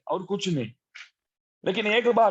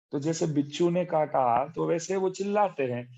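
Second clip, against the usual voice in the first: normal talking voice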